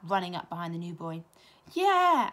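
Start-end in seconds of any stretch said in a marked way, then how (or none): 1.7-2.3 eager agreement. A narrator's reading. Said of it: "added Michael".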